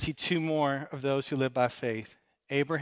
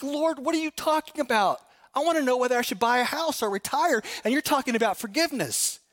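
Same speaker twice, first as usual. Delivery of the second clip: higher, fast incredulous complaint; mock whiny protest; 7 semitones higher than usual. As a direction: whine like a put-out believer. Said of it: "You're like".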